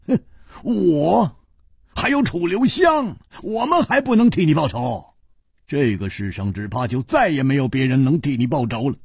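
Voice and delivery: indignant friend shouting at the crippled host; angry shout. A narrator's reading. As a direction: shout it out, insulted that he doubts you. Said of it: "怒喊道".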